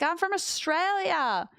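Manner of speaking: high, slow eager reply; eager self-quote. Retold or imitated. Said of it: "And I'm like".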